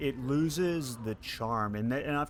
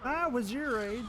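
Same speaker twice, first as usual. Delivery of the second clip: put-on old Southern man voice; 12 semitones higher than usual. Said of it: "The old voice definitely had the southern accent".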